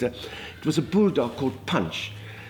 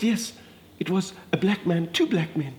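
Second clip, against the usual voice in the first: normal talking voice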